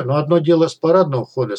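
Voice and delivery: ordinary delivery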